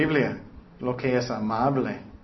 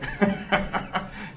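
smug chuckle; 5 semitones higher, 4 dB quieter than usual. Retold or imitated. Mocking a complacent churchgoer.

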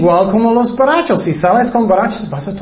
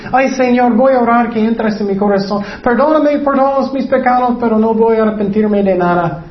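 higher-pitched, hammed-up pleading prayer; mock tearful penitent; 3 semitones higher than usual. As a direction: imitate an insincere churchgoer begging with fake sobs. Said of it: "personas lloran no significa que ellos arrepentieron".

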